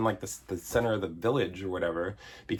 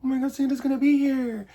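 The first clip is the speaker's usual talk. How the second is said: put-on much higher, squealing thrilled kids; mock excitement; 4 dB louder than usual. Kids waiting for Santa, squealing with mock excitement. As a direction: gush like giddy children.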